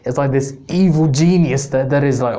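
ordinary delivery

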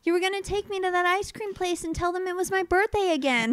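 put-on high-pitched child's complaint; imitated whining kid. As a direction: play a kid pestering dad. Voices the son pleading.

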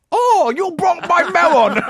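very high, slow laughing tourist voice; mock Japanese tourist. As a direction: overdo the wailing tourist, laughing. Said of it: "do your racist voice".

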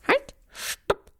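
high squeaky cry to stop; noodle shouting. Acted. Noodle stops the owl from eating it, high and squeaky. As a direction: squeal desperately.

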